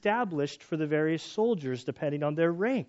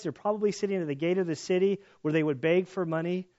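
normal talking voice

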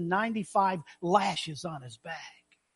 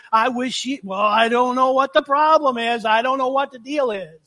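higher and louder, exasperated muttering; impatient grumble; 4 semitones higher, 6 dB louder than usual. Acted. Husband tapping his toe waiting for his bride, impatient grumble. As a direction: huff like a bored husband stuck waiting.

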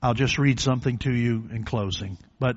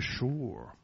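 soft sure; 12 dB quieter than usual. Retold or imitated. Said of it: "I think he said".

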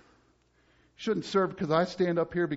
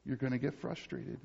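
said much softer and lower; a quiet echo of the warning; dropped soft; 8 semitones lower, 13 dB quieter than usual. Shown as a demonstration. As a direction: let it fall away to a hush. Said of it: "repeated softer".

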